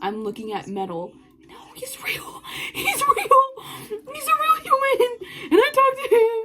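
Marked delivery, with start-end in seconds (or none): 1.5-2.7 very soft, voiceless whisper; hushed awestruck whisper; stunned whisper. Acted. Overwhelmed that idol is real. 2.7-6.3 very high, mock-crying squeal; overwhelmed high-pitched wail; tearful fangirl squeal. Overwhelmed that idol is real.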